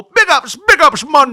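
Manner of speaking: loud, high mock Jamaican patois; hammed-up Jamaican hype man. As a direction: shout it in fake island accent. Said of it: "listened to [name] go".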